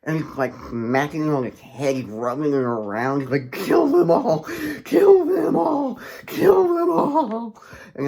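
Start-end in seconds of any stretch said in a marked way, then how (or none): 3.5-7.6 high, loud screeching chant; shrieked, drawn-out clown chant; Pennywise. Retold or imitated. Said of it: "as he's chanting".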